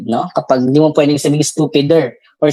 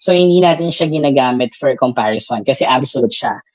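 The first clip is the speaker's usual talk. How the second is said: ordinary delivery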